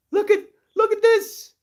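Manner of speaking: high, loud, hammed-up amazement; amazed exclaim. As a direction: act dazzled by beauty all around. Acting amazed at outside beauty.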